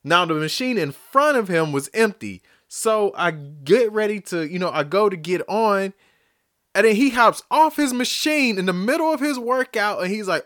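ordinary delivery

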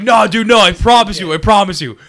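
high, loud pleading "I promise you"; insistent drunk shout. Retold or imitated. Drunk stranger insisting to Dan, shouting and high. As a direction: shout it like a drunk swearing he's telling the truth. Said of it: "he was just like".

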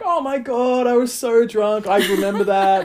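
high mock embarrassed gush; embarrassed girlfriend. Girlfriend realising they already met, high embarrassed gush. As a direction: play her squealing with embarrassment. Megan remembering drunk night.

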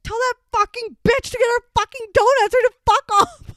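high loud furious yell, imitating her friend; mock furious shriek; retold angry yell. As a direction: mimic an angry friend screaming to give up.

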